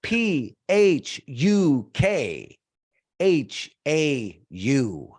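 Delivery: each letter slow and separate; spelled out loud. Shown as a demonstration. Leading the audience to spell the letters slowly. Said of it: "say these letters out loud with me".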